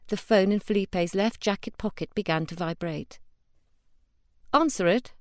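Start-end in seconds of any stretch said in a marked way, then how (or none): 4.5-5.1 demanding. Acted. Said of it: "she demanded".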